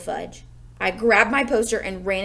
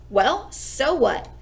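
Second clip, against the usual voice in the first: plain reading voice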